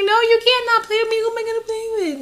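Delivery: put-on high, pleading child voice; a bratty little kid whining; whiny kid protest. Young self begging cousins to keep playing, high whiny child voice. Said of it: "And I was like".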